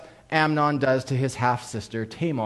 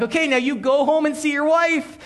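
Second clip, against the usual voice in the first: mock cheery order; 9 semitones higher than usual. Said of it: "He's like".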